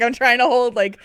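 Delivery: usual speaking voice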